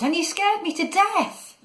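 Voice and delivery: high-pitched indignant complaint; peeved, squealed accusation; snapping. A narrator's reading. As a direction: complain crossly, voice shooting up. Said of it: "he snapped".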